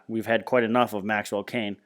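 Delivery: ordinary delivery, nothing marked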